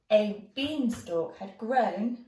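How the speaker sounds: plain reading voice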